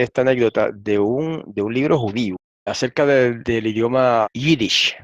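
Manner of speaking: ordinary talk, nothing marked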